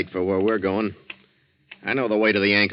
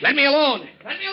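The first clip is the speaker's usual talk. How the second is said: high, loud frantic refusal; panicked cry; 3 dB louder, faster than usual. Acted. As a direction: break free in panic. Danny panics and flees.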